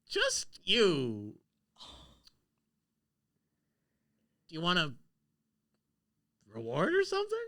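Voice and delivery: high, loud cackling hag. Acted. Hag gloating to the players: high, loud hag voice. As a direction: squeal it out like a gleeful old hag. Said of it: "The hag comes down and goes".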